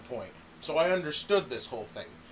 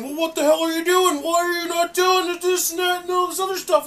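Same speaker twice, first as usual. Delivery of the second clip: higher, louder whiny bitching; mock ref-yelling Steamboat; 10 semitones higher, 7 dB louder than usual. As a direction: complain at the referee like a hothead. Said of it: "bitching at the referee, going".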